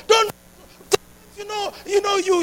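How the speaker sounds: ordinary talk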